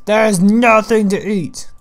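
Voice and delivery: loud, high, drawn-out complaint; exclaiming. Real, not acted. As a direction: cry it out slowly in dismay. Exclaims over an empty chest.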